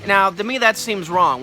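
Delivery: ordinary delivery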